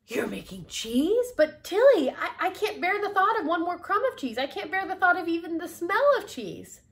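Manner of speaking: high, loud, distressed outburst; appalled protest. Acted. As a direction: protest in horror, pitched high.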